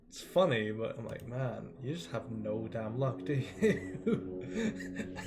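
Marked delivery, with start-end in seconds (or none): 1.3-5.3 laughing through pain. Real, not acted. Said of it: "hurts to laugh".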